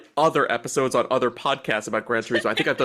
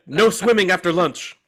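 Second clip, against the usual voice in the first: louder, higher quoted order; mock yell; 5 semitones higher, 5 dB louder than usual. Mocking the counselor yelling at a child, mock yell. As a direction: bellow a camp rule in jest. Quoting yelled counselor line.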